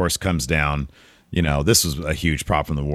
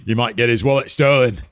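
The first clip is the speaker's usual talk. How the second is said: bad British accent, pitched up; hammed-up, higher British accent; British Bulldog; 6 semitones higher than usual. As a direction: do a clumsy imitation of a British wrestler. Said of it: "That was not a good Bulldog accent".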